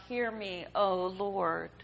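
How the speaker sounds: put-on mother pleading for her dying child, calling out to God; crying out to the Lord. A narrator's reading. Hagar's desperate cry to the Lord, first time. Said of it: "all others cried out".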